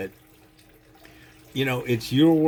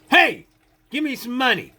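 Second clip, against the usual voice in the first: sudden loud, much higher yell from behind; startling shout; 11 semitones higher, 6 dB louder than usual. Retold or imitated. Panhandler sneaking up behind a stranger, sudden high shout. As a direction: bark it out to make them jump. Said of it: "sneak up behind you, right, and then go".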